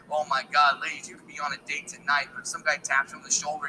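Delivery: usual speaking voice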